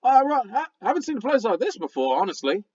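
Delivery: high-pitched, squeaky gravelly critter; talking raccoon. Acted. Raccoon companion puzzling over a strange place, squeaky and gravelly. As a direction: play a squeaky little creature with gravel in it. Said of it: "he says".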